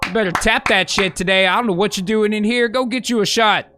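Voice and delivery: ordinary talk, nothing marked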